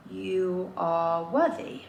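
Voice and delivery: slow, spaced-out affirmation; dictating slowly to oneself; writing aloud. Real, not acted. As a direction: say each word slowly as you write it. Says affirmation while writing it.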